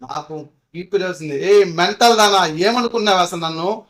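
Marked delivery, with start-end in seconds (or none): 1.5-3.8 loud, higher furious yell; shouting at girlfriend in anger; retold yell. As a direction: shout the insult at her. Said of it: "కొంచెం గట్టిగా అరిచా".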